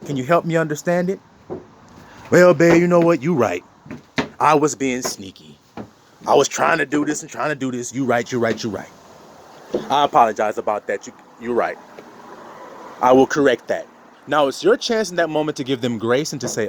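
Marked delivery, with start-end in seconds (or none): 2.3-13.9 sheepish apology. Acted. Partner admits being sneaky to spouse, low and sheepish. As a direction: own up gently to your partner.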